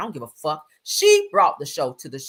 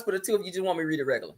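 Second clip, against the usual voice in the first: rushed; 5 dB quieter, much faster than usual. Real, not acted. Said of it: "should I read this in male voice?".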